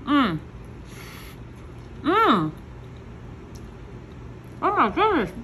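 talking through a mouthful; mouth full. Real, not acted. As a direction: hums around a big bite. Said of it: "put this whole bite in my mouth".

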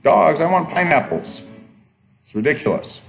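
normal talking voice

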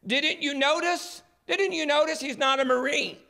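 a reporter yelling his disbelief at the Marine; retold shout. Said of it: "he shouted at the Marine".

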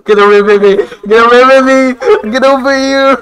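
high teasing calls; playful taunting. Pretend. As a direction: taunt them like prey. Taunting ghosts.

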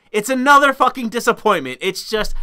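ordinary delivery

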